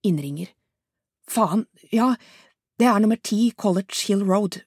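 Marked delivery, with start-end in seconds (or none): 1.3-4.6 louder, breathless and rising to a higher cry for help; frantic woman calling emergency services; panicked caller. Acted. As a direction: beg for help in terror.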